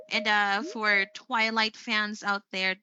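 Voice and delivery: normal talking voice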